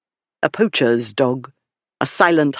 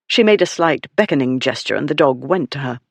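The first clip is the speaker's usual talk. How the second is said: ordinary delivery, nothing marked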